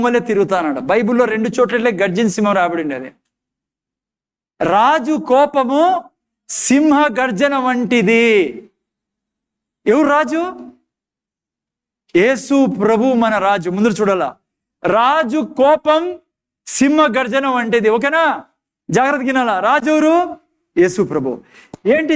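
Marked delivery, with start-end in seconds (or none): 4.6-8.2 very slow, higher, drawn-out verse; weighty drawn-out scripture; solemn proverb reading. Acted. 14.8-17.9 very slow, higher, drawn-out verse; weighty drawn-out scripture; solemn proverb reading. Acted. Proverb repeated for emphasis.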